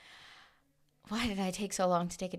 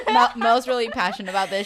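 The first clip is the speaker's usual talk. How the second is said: loud, laughing through the words; 3 semitones higher, 10 dB louder than usual. Real, not acted. Teasing her friend about loving astrology, laughing. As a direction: crack up while teasing.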